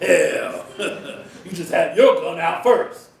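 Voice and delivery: loud, laughing scorn. Acted. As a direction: laugh through the words. Vernon laughs at Kurt.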